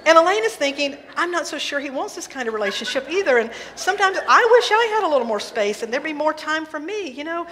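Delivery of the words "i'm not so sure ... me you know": higher and louder, worry spilling over; anxious rising chatter. Acted. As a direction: Elaine spiraling in her head, voice climbing higher and louder. Elaine's escalating anxious thoughts.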